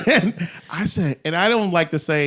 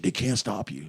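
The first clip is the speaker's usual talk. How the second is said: much lower and softer; imitated confiding aside; 7 semitones lower, 11 dB quieter than usual. Yogurt shop dude advising a customer, imitated low confiding. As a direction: drop it right down, as if passing on a secret.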